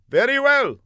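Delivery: earnest, high put-on foreign voice; an eager young spy calling out; high comic foreigner. A narrator's reading. Fla calls down the block to the older man, high and eager.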